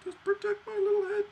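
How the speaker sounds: very high, whimpering mock soldier voice; whiny British soldier. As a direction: squeak it out like a crybaby soldier begging for a hat. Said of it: "He's like".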